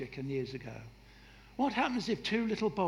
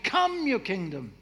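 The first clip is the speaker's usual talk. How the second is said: loud, high, forceful command; commanding declaration; 4 semitones higher, 8 dB louder than usual. Shown as a demonstration. Preacher shows how to pray, commanding and loud. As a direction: put your foot down and declare it. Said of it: "raising their fist and saying".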